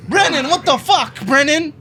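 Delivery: high, loud protest; outraged yelling. Real, not acted. Player protesting to the game master, outraged. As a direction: yell at a friend in disbelief.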